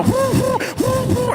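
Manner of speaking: high-pitched quick whoops; high whooping. Acted. Friend frantically airing out the car, high whooping. Acted panic to air out car.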